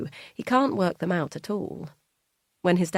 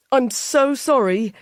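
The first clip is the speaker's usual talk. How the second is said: bursting in, louder and higher; loud apology; 7 semitones higher, 9 dB louder than usual. Acted. Son bursts in late apologising to his mum, loud. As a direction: call it out as you rush in. Charlie bursts through the door.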